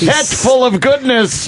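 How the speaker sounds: high, slow announcer sing-song; John Sterling announcer call. Pretend. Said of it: "John Sterling can announce".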